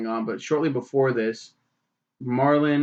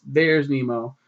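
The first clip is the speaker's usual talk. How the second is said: normal talking voice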